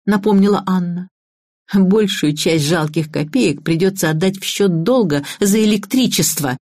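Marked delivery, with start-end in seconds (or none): none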